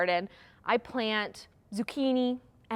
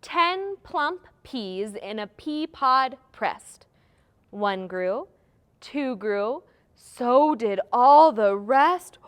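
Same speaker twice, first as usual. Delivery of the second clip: nursery rhyme; 5 dB louder, slower than usual. Shown as a demonstration. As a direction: draw out each beat for children to follow. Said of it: "teach you this rhyme".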